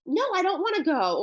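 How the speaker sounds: a hint of a kid's high-pitched refusal; protesting child. Child refusing a parent at the park, mildly protesting. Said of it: "And the child is saying".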